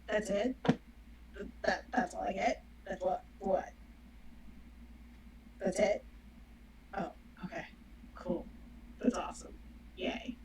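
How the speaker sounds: sarcastic. Shown as a demonstration. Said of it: "That's sarcasm".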